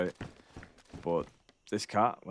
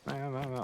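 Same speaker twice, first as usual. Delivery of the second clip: soft, high string of meows; imitated cat meowing; 5 semitones higher, 5 dB quieter than usual. Owner mimicking his cat all the time, soft meowing. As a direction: meow repeatedly, small and high. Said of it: "the cat's there, and he's like".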